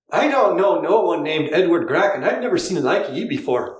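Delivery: put-on a light Irish accent, high and laughing; Irish accent, amused. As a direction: play a gruff Irish ferryman. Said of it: "You do the Irish voice".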